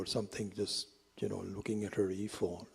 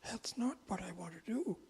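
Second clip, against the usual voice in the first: much higher, lamenting plea; mock wailing Arjuna; 10 semitones higher than usual. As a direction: cry out your despair about the war. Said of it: "Arjuna is wailing".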